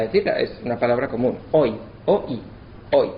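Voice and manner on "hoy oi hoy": hoy as a single gliding syllable oi; one syllable. Shown as a demonstration. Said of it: "una sílaba".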